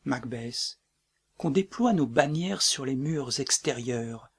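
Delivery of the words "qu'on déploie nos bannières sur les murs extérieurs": usual speaking voice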